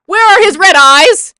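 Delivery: loud, high-pitched yell; outraged shout. Real, not acted. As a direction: yell the question in exasperation. Outraged rant at missing detail.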